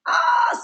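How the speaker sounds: sudden very high yelp of overwhelm; exasperated high ah; overwhelmed cry. Acted. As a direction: cry out at the noise. Mimics the overwhelm of online noise.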